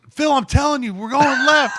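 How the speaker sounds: insistent high shout; imitated shouting match, loud and high; Nick. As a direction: yell to win the argument. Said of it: "they're just yelling at each other, dude, like, screaming".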